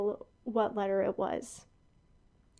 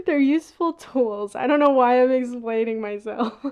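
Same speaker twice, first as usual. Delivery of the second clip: laughing it out, louder; 7 dB louder than usual. Real, not acted. To viewers about cat ear headphones, laughing. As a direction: break into laughter while explaining.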